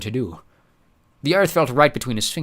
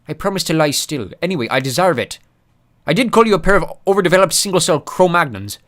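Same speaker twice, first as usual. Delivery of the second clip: dwarf voice; 8 semitones higher, 7 dB louder than usual. Acted. Mulch voiced.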